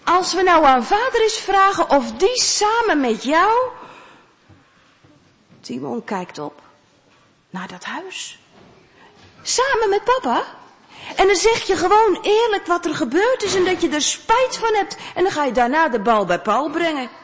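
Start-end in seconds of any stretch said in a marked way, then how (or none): 0.0-3.7 mother's suggestion. Acted. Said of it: "zegt moeder". 9.4-17.1 put-on child's surprise. Timon then mother, high voices.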